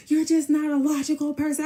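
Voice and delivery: high, loud mimicked complaint; hammed-up high-pitched yell; mock yelling. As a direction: imitate him hollering at you.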